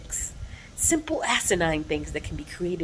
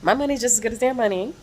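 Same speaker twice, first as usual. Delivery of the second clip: mock indignant customer; indignant sass; 7 semitones higher, 5 dB louder than usual. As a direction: call out being served last, offended. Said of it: "And I'm like".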